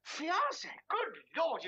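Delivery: high-pitched, amazed question; astonished. Acted. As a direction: blurt it out in sheer surprise. Astonished by news of engagement.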